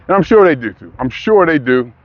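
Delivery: usual speaking voice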